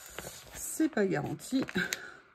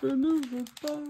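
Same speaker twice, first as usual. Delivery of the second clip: mock defiance; 3 semitones higher than usual. Crafter talks back to her own failing voice, mock defiant. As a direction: answer back with hammed-up stubbornness.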